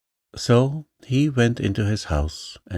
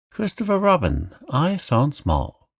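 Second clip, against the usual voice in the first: much higher, proud announcing voice; proud cry; 7 semitones higher than usual. A narrator's reading. Piglet telling the boy his find, proud cry. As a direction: show off the find. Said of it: "cried Piglet".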